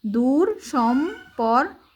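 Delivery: দূর সম্পর্কের slowly, "door... shom... por... ker"; slow, broken-up teacher's reading; syllable-by-syllable reading. Shown as a demonstration. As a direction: sound out দূর সম্পর্কের in separate beats. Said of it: "তারপর র".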